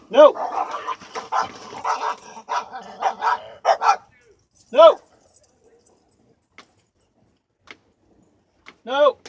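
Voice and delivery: sharp, high, loud shout; shouted command. Real, not acted. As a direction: shout to stop a dog, one word at a time. Shouting at a dog nearby.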